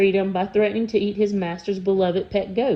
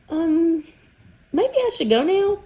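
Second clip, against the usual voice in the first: much higher, hesitant voice; nervous boy; 9 semitones higher than usual. Acted. The boy to the sniffing trolls, nervously. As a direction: edge away politely, uneasy.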